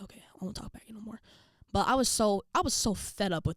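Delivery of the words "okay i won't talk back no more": whispered, cowed promise; sheepish whisper. Retold or imitated. Daughter promising dad she'll stop, sheepish whisper. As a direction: imitate a chastened kid, barely voiced.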